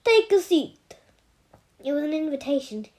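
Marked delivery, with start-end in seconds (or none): 0.0-1.0 higher, louder order; commanding. Acted. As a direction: make the invitation a command. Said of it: "It was a command".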